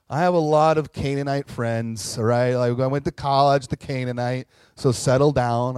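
loud, huffy mock self-justifying; defensive rant. Jesus imagined defending himself to the woman, loud and huffy. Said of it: "he could have responded and say".

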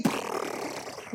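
voiceless machine-gun trill; imitated gunfire. Preacher firing slogans like bullets, sputtered gun noise. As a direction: sputter a burst of gunfire without voice.

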